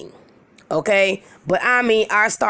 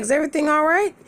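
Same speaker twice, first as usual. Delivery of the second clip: high mock-innocent question, lifting at the end; playing dumb; 8 semitones higher, much faster than usual. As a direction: act clueless, voice pitched up. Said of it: "So I play dumb".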